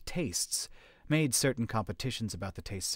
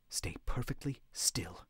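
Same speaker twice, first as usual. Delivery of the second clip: slow hushed whisper; 6 dB quieter, slower than usual. A narrator's reading. Warning the group to freeze, whispered. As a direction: breathe it out so nothing hears. Hiding from the buzzing danger.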